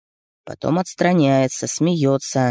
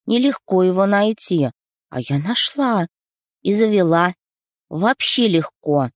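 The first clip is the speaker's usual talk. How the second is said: put-on high, eager child voice; little girl voice; 5 semitones higher than usual. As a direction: ask like a curious little girl. Narrator voices little daughter.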